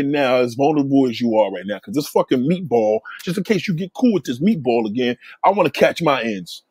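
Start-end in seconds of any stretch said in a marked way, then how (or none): none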